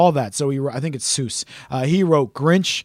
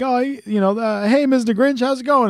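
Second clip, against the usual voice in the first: higher, cheery Who greeting; chirpy Who; 7 semitones higher than usual. Retold or imitated. A Who greeting the Grinch, high-pitched and friendly. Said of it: "he's kinda like".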